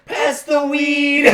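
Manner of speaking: slow, loud, high yell; teasing shout. Retold or imitated. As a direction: yell it across the room. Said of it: "my friends would be like".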